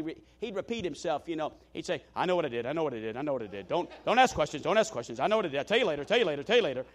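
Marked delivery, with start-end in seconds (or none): 2.1-6.8 put-on lower, repetitive old preacher voice; imitating Lee Roberson. Old preacher brushing off a young one, lower and repeating. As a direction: repeat each phrase like a stubborn old man. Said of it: "This is kinda how he talked".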